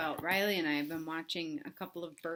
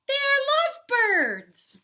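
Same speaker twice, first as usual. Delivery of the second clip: high, slow, far-off pig voice; much higher teasing tone; sing-song Piggy; 10 dB louder, slower than usual. Acted. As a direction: coo it sweetly.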